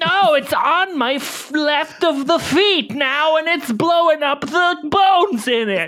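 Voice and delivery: high, hammed-up panic over "feet"; shrieking in silly fake terror; mock panic. Mower attacks her feet.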